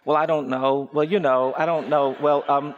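low, reluctant mumbling for words; half-hearted, hedging lead-up to an apology; insincere stalling. Retold or imitated. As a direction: stall awkwardly, acting out a reluctant boy. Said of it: "as insincerely as I possibly could".

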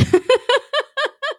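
a high, light laugh at herself; high sheepish giggle; self-conscious laugh. Real, not acted. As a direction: laugh off the admission of perfectionism. Laughs at being a perfectionist.

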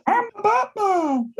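a mocking falsetto Batman; squeaky high Batman. Mocking the Batman voice [name] called too high, in squeaky falsetto. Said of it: "it was weird when he was like".

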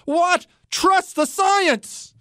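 high, slow, sing-song mockery of the slogan; mocking slogan. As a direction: sneer the catchphrase, dragging it out high. Mocks slogan he's sick of.

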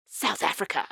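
hushed, whispered announcement; whispered reveal. Pretend. Whispered reveal of featured region.

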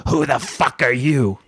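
slow, growing menace in a put-on voice; Sandman. Drunk wrestler confronting a newcomer nose to nose, slow mock aggression. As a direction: act the drunk wrestler getting in someone's face. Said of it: "this is me doing my Sandman".

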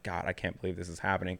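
quiet dejected groan of disbelief; despairing mutter. Retold or imitated. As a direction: mumble it to yourself in a corner. Alone in corner, despairing.

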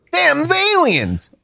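slow, high hammed-up yokel; hillbilly voice. Joke hillbilly line.

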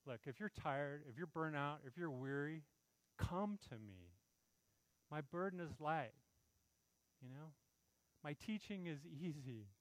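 soft, tender invitation to the weary; quiet, comforting plea; gentle voice of Jesus. Acted. Preacher voicing Jesus comforting the weary.

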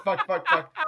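usual speaking voice

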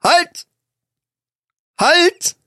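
high urgent stop shout; shouting halt. Real, not acted. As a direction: cut him off with a sharp call. Stops partner mid-sentence.

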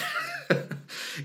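high-pitched giggle; real amused laugh. Real, not acted. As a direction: laugh at your own example. Laughs after snooze button example.

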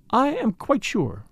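deliberate, careful confirmation; replied at length. A narrator's reading. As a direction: answer slowly after long thought. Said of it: "she replied at length".

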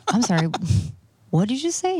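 incredulous question, climbing high at the end; retold double take, pitch leaping up; mock disbelief. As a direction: play the baffled wife asking him to repeat. Wife's retold disbelief at husband's accent.

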